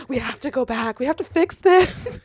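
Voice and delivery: rising, high-pitched mock desperation; mock panic. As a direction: urge it like a panicked film hero. Acts time traveller urging return to past.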